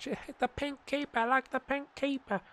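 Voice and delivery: high, lightly voiced little girl; daughter voice. Voicing his daughter's delight at the pink keeper, light high child voice. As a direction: chirp it like a small daughter. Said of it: "My daughter would like it".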